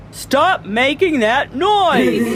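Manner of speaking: indignant principal, drawn out to "noise"; scolding principal. A narrator's reading. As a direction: order silence, stretching each word.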